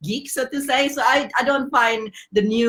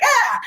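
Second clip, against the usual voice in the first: sudden high yelp of alarm; short panicked squeal; 10 semitones higher, 3 dB louder than usual. Retold or imitated. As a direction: let out a startled cry. Panicking at repair cost news.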